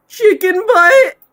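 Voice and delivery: slow loud silly yell; loud goofy shout. Pretend. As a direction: belt the punchline out. Loud slow joke punchline.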